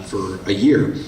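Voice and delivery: ordinary talk, nothing marked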